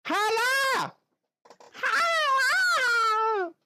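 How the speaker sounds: playful high-pitched holler; loud high greeting. Real, not acted. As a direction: sing out a hello to a friend. Greeting a chatter, calling out.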